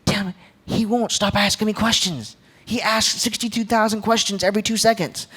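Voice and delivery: a hint of a frazzled mother complaining; exasperated mom. Mom complaining to dad about questions, lightly exasperated. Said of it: "and she goes".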